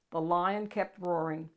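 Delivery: ordinary delivery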